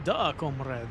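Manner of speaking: a hammed-up Russian accent on "Duh, comrade", with Duh said as Da; a jokey fake Russian "Da, comrade"; a lightly voiced Russian accent, playing the comrade; mock Russian accent. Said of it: "My terrible accent".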